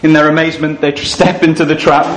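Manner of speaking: ordinary talk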